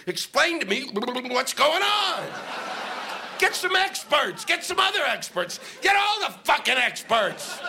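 high, slow demand rising to a yell; building shout. Real, not acted. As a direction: hammer each demand harder. Ranting at the newspaper.

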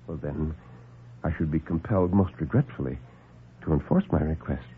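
low, soft, smooth doctor; calm the men down, unhurried and superior; suave doctor. Acted. Doctor soothes the alarmed lieutenant, low and suave.

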